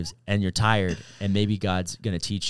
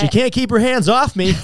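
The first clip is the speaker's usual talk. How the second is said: much higher and louder mock boast; teasing joke; 13 semitones higher, 11 dB louder than usual. Husband teasing his wife about the Irish twins, loud mock boast. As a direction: brag it loudly as a cheeky joke.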